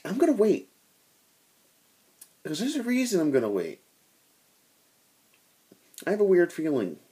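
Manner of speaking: high, cautious ranger. Retold or imitated. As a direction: hold back with a hunch. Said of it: "she's just like".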